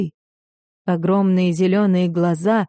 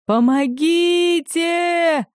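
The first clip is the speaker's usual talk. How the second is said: high, drawn-out scream for help; a long, high, terrified plea; desperate cry for help; 9 semitones higher, much slower than usual. Acted. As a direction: yell it as the waves pull you under. Man drowning in the waves.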